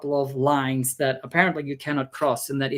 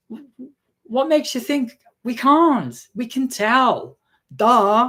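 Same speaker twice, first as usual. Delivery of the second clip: incredulous; 8 semitones higher, 4 dB louder, slower than usual. Retold or imitated. Her incredulous retort.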